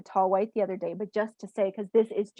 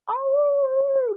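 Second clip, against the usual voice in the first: long, high, loud howl; puppy howl; 7 dB louder than usual. Retold or imitated. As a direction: howl like an excited puppy, holding the note high. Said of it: "He was howling like a puppy".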